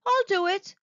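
high eager offer; eager cry. A narrator's reading. Box offers to pull the dart, eager high cry. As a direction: jump in eagerly to help. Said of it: "cried Box eagerly".

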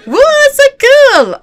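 very loud, high whoop; real excited squeal. Real, not acted. Player thrilled by the new horse, loud high squeal. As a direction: shriek with delight, stretching the words.

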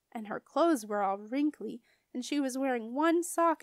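ordinary delivery, nothing marked

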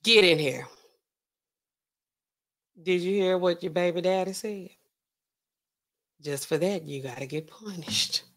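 low, softened mock threat; quiet menace. Man summoning Daphne for punishment, quiet menace.

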